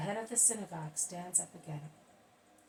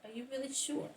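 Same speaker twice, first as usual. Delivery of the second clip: raised pitch, pointed questioning; challenging questioner; 5 semitones higher, 3 dB louder than usual. A narrator's reading. Man challenges Jesus to confirm he is Messiah, challenging. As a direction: challenge him with a higher, doubting voice.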